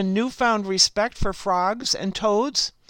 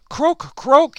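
loud, raised frog croak; crying out croaks; 5 semitones higher, 7 dB louder than usual. A narrator's reading. Frog croaking to get the ox's attention, crying out. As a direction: cry it out as two loud croaks. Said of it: "he cried".

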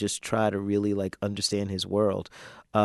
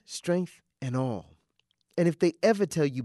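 tender spoken-word poem; 10 semitones higher than usual. Acted. Father holding his baby daughter, tender spoken word. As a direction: speak it as a blessing to a child.

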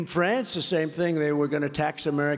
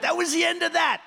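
much higher, louder triumphant shout; shouted punchline; 12 semitones higher, 3 dB louder than usual. Real, not acted. Rally speaker hammering home the win to the crowd, triumphant shout.

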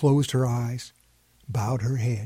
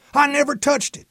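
put-on much higher, louder kid denial; child protesting; 13 semitones higher, 6 dB louder than usual. A narrator's reading. Jesse denying he touched the watch, high louder protest. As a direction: fight back like an accused kid. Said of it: "Jesse fought back".

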